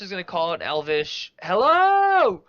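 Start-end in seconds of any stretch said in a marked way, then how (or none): 1.4-2.4 put-on high, loud drunken shout; drunken yell. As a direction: yell it as loud as you can. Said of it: "Like, really loud".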